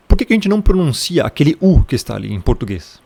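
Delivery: ordinary delivery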